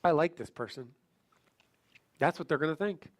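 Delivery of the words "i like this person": low voiced thought; inner thought. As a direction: think it aloud, pitched low. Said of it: "They're just gonna think what?".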